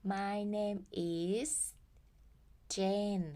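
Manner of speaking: My name is Jane drawn out slowly; teacher modelling slowly; slow model sentence. Shown as a demonstration. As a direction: spell it out slowly for learners. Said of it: "ให้พูดว่า".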